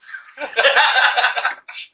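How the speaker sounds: a high, loud burst of laughter. Real, not acted. Laughs at his own joke.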